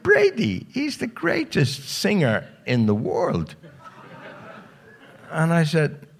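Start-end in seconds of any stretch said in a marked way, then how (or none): 0.0-3.5 high, slow mock female voice; lightly mocking the caller; woman on the phone. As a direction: put on a prim lady's voice.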